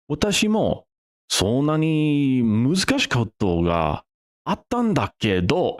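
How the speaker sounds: high-pitched Japanese with native-style inflection; switched to Japanese inflection. Retold or imitated. Texan suddenly switching to Japanese, high Japanese inflection. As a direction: raise the register, with Japanese intonation. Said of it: "He changed the register of his voice".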